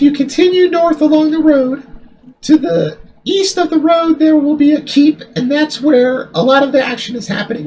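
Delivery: high falsetto woman's voice; man doing a woman's voice; fake girl voice. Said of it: "I'll just go normal voice".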